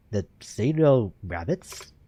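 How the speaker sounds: low, slow, puzzled detective. Acted. Voices Yagami replying.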